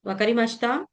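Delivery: ordinary delivery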